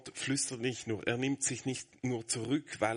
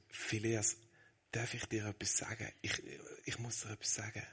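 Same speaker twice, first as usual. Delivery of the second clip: put-on much softer, tender hush to a small child; 8 dB quieter than usual. Father quietly asking his five-year-old son, soft hush. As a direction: lean in and speak gently. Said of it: "Und ich frage ihn".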